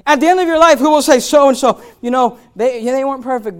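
loud, high, driving sermon voice; loud rebuke from the pulpit; full-force preaching. Acted. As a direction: preach it hard at the room.

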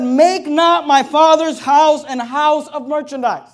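slow, loud and proclaiming; booming scripture. Acted. Pastor voicing Jesus in the temple, slow and booming. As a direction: thunder each word of the verse out.

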